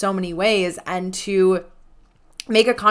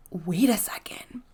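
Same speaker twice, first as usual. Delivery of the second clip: whispered aside; 8 dB quieter than usual. Retold or imitated. Stunned by his sudden play.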